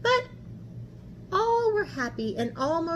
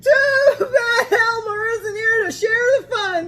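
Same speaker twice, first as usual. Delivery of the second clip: much higher and louder, laughing through the words; 10 semitones higher, 10 dB louder than usual. A narrator's reading. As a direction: crack up while you say it. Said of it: "they said, laughing harder and harder".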